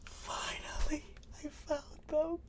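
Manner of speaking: high, breathy laughter through the words; happy laugh-cry. Real, not acted. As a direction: laugh and almost cry with relief. Overjoyed at finding the books.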